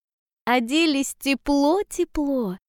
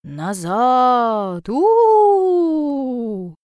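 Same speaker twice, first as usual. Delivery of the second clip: howling blizzard winds; 6 dB louder, much slower than usual. A narrator's reading. Blizzard ordering travellers back, loud drawn-out howl. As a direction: stretch it into a wolfish wind howl. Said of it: "выли вихри".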